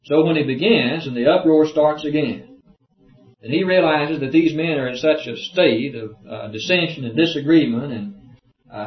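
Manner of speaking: usual speaking voice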